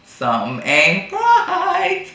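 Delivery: slow, high knowing drawl; suspicious. Retold or imitated. Hearing about the notary, a slow suspicious aside. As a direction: let the suspicion sink in slowly. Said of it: "I was like".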